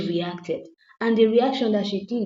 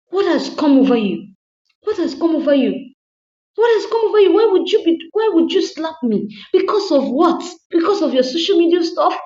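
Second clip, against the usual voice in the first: rising, louder with each repeat; shocked angry husband; 9 semitones higher, 6 dB louder than usual. Retold or imitated. Husband confronting wife after slap, climbing outrage. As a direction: demand answers, getting higher each time.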